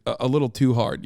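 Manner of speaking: ordinary talk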